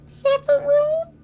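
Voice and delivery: Sufferone said very high and slow, syllables stretched; drawn-out pronunciation. Shown as a demonstration. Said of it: "It's more like sufferone".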